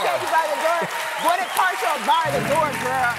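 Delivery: loud high rallying cry; shouting. Pretend. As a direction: belt it out with a laugh. Echoing the shout louder.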